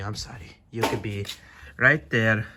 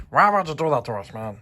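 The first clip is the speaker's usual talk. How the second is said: ordinary talk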